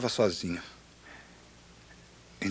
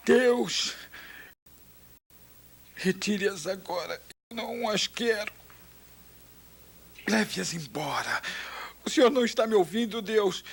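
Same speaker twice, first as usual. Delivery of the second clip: desperate crying shout; 10 semitones higher than usual. Retold or imitated. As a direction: cry out like a weaned calf. (grito choroso e desesperado, bem agudo)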